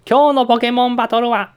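loud, high, drawn-out verdict; referee announcer. Pretend. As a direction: call it out like a referee. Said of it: "審判が言いました".

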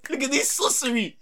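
very high, loud laughing squeal; hammed-up disbelief; shrieking astonishment; comic shock. Shocked she knew his name.

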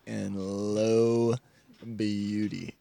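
soft slow showman. Acted. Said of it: "Ben came in there soft".

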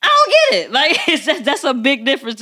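pitched high in laughing disbelief; incredulous. Real, not acted. Woman baffled by the double standard, high incredulous laugh. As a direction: squeal out the disbelief.

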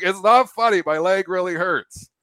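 high, loud, hurt protest with the last words slowed; pained protest. Retold or imitated. Trapped friend protests to the laughing ambulance crew, loud and hurt. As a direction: snap back at the people laughing. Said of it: "He's like".

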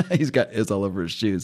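real laugh breaking into the words; laughing at himself. Real, not acted. Podcast host recapping a comic, laughing at his own line. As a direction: try to finish while cracking up. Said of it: "I'm just laughing at myself here".

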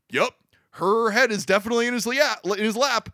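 cheery report building to a loud whoop; imitating a weird line reading. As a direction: ham up the agent's odd gleeful line, end in a big whoop. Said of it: "That was a weird line reading".

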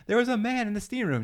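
high-pitched imitation of upset women; women's complaint. Women reporting to a spa employee, high mock-upset voice.

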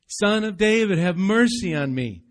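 loud desperate cry. Retold or imitated. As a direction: call out over the crowd. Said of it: "you hear he's crying out".

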